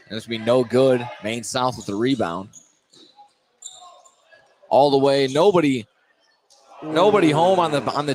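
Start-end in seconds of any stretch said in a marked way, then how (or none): 4.7-8.1 excited call. Real, not acted. Play-by-play call on a fast break.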